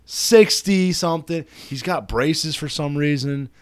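ordinary delivery

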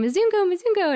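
high-pitched mock scream of toddlers; imitated child's scream. Storyteller imitating toddlers pointing at a foreign visitor, mock high scream. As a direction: call out high like tiny children screaming from afar.

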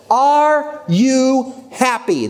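very slow, high, each word separated; word-by-word question. Retold or imitated. Speaker repeating his one question to the audience, slow and emphatic. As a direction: spell out the question one drawn-out word at a time.

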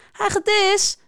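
high-pitched call; calling after someone. A narrator's reading. Schildpad calls after the lizard flashing by, calling out. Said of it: "roept Schildpad hem na".

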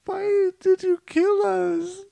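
slow, very high falsetto; parent back from the dead. Acted. Dead parent asking the child about the killing, falsetto parent. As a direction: ask in a drawn-out piping falsetto.

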